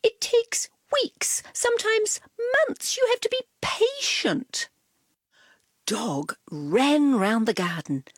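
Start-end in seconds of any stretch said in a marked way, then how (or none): 0.0-4.7 high, patient cat voice; calm a restless puppy; lightly voiced cat. A narrator's reading. Cat tells Dog to be patient, high. Cat's voice in read story.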